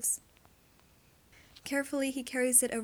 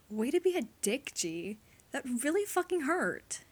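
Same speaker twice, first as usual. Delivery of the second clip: grumbling; 4 semitones higher than usual. Acted. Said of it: "he grumbles".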